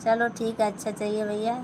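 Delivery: normal talking voice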